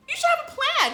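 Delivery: very high, loud scolding; exasperated yell. Acted. Woman scolding her planless date, exasperated yell.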